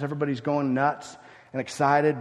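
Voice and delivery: ordinary delivery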